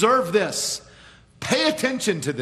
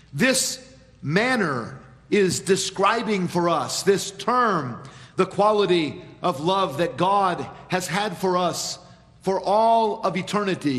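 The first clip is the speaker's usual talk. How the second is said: usual speaking voice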